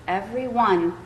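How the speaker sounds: plain reading voice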